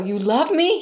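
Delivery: high-pitched, incredulous question; retold astonishment. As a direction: act out being taken aback, voice lifting high. Acting her astonished reply.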